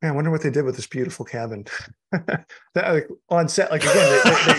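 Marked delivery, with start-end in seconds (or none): 2.1-4.6 laughing, higher and louder. Real, not acted. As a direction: giggle through it. Laughing about the cabin set.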